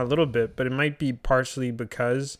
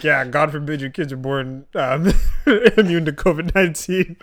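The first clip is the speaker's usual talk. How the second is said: sarcastic, laughing; 7 dB louder than usual. Retold or imitated. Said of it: "I literally said".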